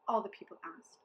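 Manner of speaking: ordinary delivery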